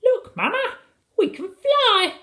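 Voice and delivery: put-on very high, excited little boy; squeaky, thrilled chirrup; chirruping child. A narrator's reading. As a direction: pipe it up with delight. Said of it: "chirruped Philip".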